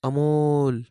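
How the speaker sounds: desperate scream. A narrator's reading. Falling girl screams for Amol at the tracks, loud desperate cry. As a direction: scream out Amol as you slip. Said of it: "ती ओरडली होती".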